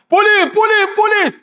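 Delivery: high, urgent calling to the police; lost man calling for help. Retold or imitated. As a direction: flag down the policeman, pitched high.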